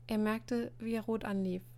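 plain reading voice

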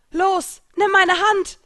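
panicked yell reaching down to a falling friend; panicked shout. Acted. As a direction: scream the order in panic. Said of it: "rief sie panisch".

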